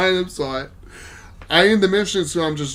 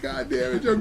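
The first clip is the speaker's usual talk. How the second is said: mock exasperation; 8 semitones higher, 3 dB quieter than usual. Friends groaning at failed mission.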